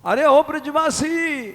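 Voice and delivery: very high, loud searching call. Acted. Sadhu calling again for the vanished villager, very high and loud.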